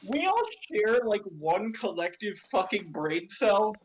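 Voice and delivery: ordinary delivery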